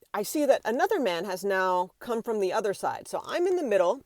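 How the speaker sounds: ordinary talk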